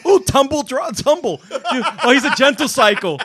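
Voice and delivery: high, laughing mock commentary. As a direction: crack up while joking. Joking laundry commentary, laughing.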